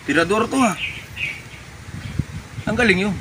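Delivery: usual speaking voice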